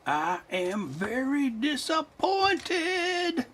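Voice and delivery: high, very slow and flat-toned line; Fifth Element impression. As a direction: drag it out slowly like a disappointed alien. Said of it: "That's my Fifth Element impression".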